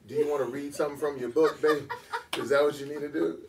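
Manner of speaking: high, loud mock-sweet condescension; sweetly condescending. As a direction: imitate a lady humoring him like a child. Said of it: "very sweet but very condescending".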